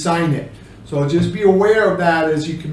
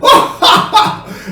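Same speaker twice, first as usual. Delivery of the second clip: high breathy belly laugh; 5 dB louder than usual. Shown as a demonstration. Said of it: "you're laughing".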